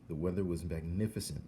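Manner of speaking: usual speaking voice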